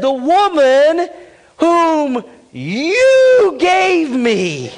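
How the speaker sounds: high, loud, slow accusation; accusing Adam. Acted. Adam blaming God for the woman, high and slow. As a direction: drag it out, pointing at God.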